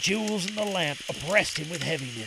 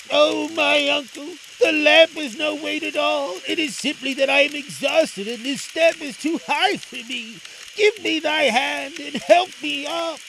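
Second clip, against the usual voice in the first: put-on high, raised boy's voice; pleading Aladdin; 8 semitones higher, 4 dB louder than usual. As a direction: implore an uncle for help. Aladdin pleads exhausted with uncle.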